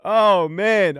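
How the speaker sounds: ordinary talk, nothing marked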